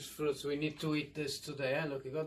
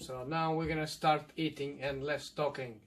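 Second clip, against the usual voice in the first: ordinary delivery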